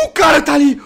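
loud alarmed yell; startled shout. Real, not acted. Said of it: "spots the enemy, yells".

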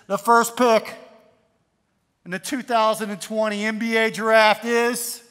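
loud, ringing draft announcement; draft announcer. Acted. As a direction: announce the first pick to a big hall. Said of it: "then they get their hat, and it says".

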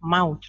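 "mouth" with an audible TH at the end; final TH sounded. Shown as a demonstration. Teacher models "mouth" for pupils, final TH sounded. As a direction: say "mouth" slowly, releasing the closing TH. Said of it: "ออกเสียงข้างท้ายด้วย ทีเอช".

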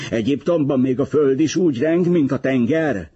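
high-pitched indignant question; angry. A narrator's reading. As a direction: raise the pitch in angry complaint. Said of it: "mondta dühösen". (dühös, magas hangú kérdés)

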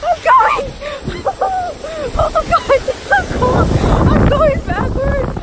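screaming down the slope; excited screaming. Real, not acted. Sledding backwards screaming.

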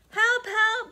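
high, loud panicked cry for help; frightened bellow. Acted. Frightened bear crying for help to Elephant. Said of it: "bellowed Bear".

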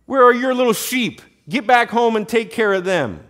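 high, loud taunting order; angry big brother. Acted. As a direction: send a little brother packing. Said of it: "he was angry".

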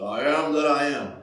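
low, booming voice from the sky; God voice. Shown as a demonstration. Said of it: "That's my God, my God voice".

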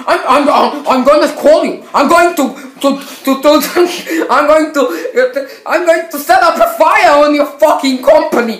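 high, loud, half-laughing fury; raging rant. Real, not acted. Gamer ranting at EA Sports after the loss, loud laughing fury. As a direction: rant at the game through laughter.

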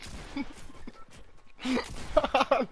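breathless squeal of laughter; high wheezy laughter; high wheezing laugh. Real, not acted.

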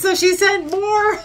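high laughing words; real laughter while speaking. Real, not acted. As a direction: laugh through it, tickled by the gift. Laughing at gift.

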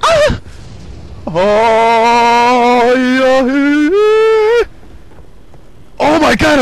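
high, loud victory shrieks; thrilled gamer yelling; excited screaming. Real, not acted. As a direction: scream with real glee at winning. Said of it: "I started screaming a little bit much".

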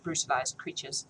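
usual speaking voice

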